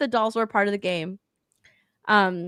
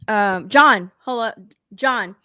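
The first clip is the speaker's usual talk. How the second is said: ordinary delivery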